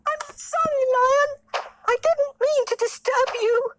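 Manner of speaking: very high squeaky flattering mouse; tiny pleading mouse. Acted. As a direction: sweet-talk the king in a squeak. Mouse apologizes again.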